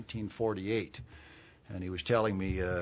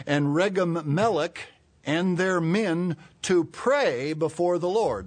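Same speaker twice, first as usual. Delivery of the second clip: raised, strong Bible reading; scripture reading; 4 semitones higher, 4 dB louder than usual. Real, not acted. Preacher reads Zechariah as an example, forceful scripture reading.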